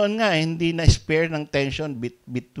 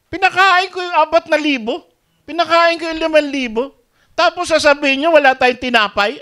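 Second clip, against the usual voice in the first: loud, high exasperated rebuke; the Lord scolding; 12 semitones higher, 8 dB louder than usual. Retold or imitated. The Lord rebuking his faithless disciples, loud and high exasperation. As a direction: scold them like a fed-up master. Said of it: "narinig ng Panginoon".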